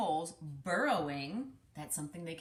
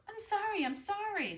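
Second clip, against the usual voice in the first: high apologetic mole; flustered apology; sorry mole; 11 semitones higher than usual. Acted. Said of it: "said the mole".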